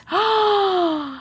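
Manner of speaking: high, drawn-out gasp; big intake of breath; delighted gasp. Real, not acted. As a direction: gasp in amazement at the news. Reacting to news of changed lyric.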